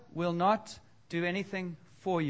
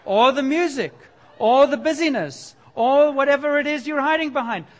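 loud, higher preaching build; 7 semitones higher, 9 dB louder than usual. Real, not acted. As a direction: drive it home, louder and higher. Preacher rises to full force.

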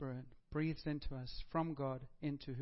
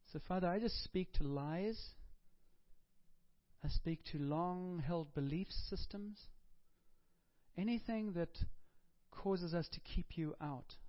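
closing prayer. Acted. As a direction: speak gently against the lies.

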